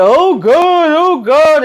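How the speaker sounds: high, hammed-up moaning; mock pained wailing. Lily waiting for the pain pills to kick in, mock pained wailing. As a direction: wail as if the pain won't stop, exaggerated.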